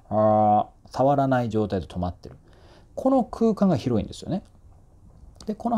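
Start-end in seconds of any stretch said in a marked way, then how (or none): 0.1-0.7 loud held "あ" as English R, tongue in mid-air; English R, tongue missing. Shown as a demonstration. Coach shows the tongue stopping untouched, "あ" as a loud English R. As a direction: sustain "あ" as an R without tongue contact. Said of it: "空振るというか".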